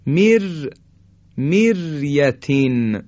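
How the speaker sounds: مریتین slowly, thin R after مر; light R. Shown as a demonstration. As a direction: recite مر, then drawn-out مریتین, R light. (مریتین کشیده با «ر» نازک)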